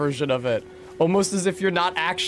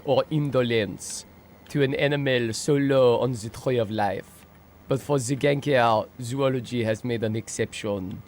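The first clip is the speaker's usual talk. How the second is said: nature documentary. Acted.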